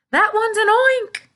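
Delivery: high, loud frightened scream. A narrator's reading. Fire chief screaming at the giant peach. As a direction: shriek it in panic. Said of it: "screamed the head of the fire department".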